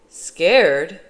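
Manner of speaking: loud, questioning echo; incredulous. A narrator's reading. Father questions his son's remark, loud and incredulous. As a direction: throw the word back loudly as a question.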